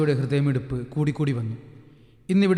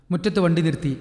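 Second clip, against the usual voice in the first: plain reading voice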